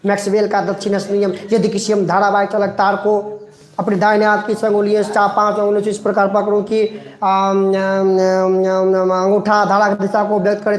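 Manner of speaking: rattled off fast with mumbled filler; mock rote cramming. Shown as a demonstration. Teacher mocks students cramming the rule, rushed and mumbling. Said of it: "आप लोग देखिए घर में ऐसे पढ़ते होंगे".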